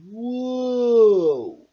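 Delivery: loud, long dizzy whoa; tumbling whoa. A narrator's reading. Friends tumbling across the sand, loud whoa. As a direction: hold the whoa as you spin.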